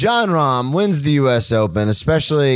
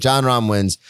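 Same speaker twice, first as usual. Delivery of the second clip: ordinary delivery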